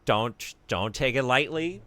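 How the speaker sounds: playful loud plea; mock stern warning. Real, not acted. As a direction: warn them off loudly, tongue in cheek. Warning viewers after disgust joke.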